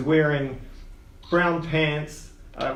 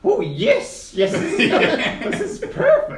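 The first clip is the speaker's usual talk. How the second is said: high, slow, gleeful gnome with a laugh; gleeful old gnome; 5 dB louder, slower than usual. Acted. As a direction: savour it like a mad old man. GM voices Quince gleeful.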